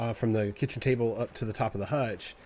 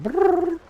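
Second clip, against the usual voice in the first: high trilled brrr like a cat; chirpy cat trill; cat's bird chirp; 7 dB louder than usual. Retold or imitated. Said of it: "this bird cow that he does".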